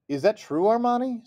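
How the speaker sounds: put-on high, wide-eyed little girl voice; eager little sister. A narrator's reading. Little sister asking Armani at the table, high and eager. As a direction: ask with big hopeful puppy eyes. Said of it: "Seely asked with big puppy eyes".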